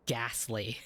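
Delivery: usual speaking voice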